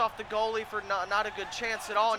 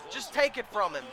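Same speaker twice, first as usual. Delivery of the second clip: usual speaking voice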